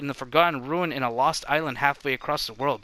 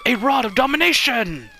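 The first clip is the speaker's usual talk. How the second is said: high, loud, thrilled cry; excited exclamation; 9 semitones higher, 6 dB louder than usual. Acted. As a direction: gasp out the treasure's name. Character spots priceless artifact.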